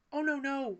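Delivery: high, flustered stammer. A narrator's reading. Wallace stammers to undo his blunder. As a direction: backpedal in a fluster. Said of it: "Wallace stammered".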